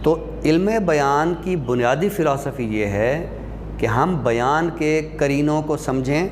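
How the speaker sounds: ordinary talk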